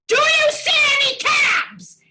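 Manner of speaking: high, loud shrieked question; mock shrill friend. To her friend in the blizzard, a high loud shrieking question. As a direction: squeal it like a frantic friend. Friend asking in retold story.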